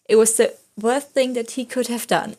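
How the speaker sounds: ordinary talk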